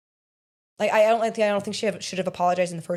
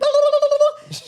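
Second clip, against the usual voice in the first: squealing laugh; 8 dB louder than usual. Retold or imitated. Mocking the fan's reaction.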